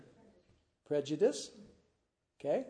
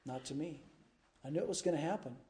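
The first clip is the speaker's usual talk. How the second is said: tender comfort; 9 dB quieter, faster than usual. Retold or imitated. Lord comforting the failing believer.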